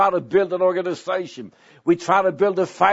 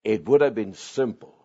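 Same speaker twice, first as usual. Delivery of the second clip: ordinary talk, nothing marked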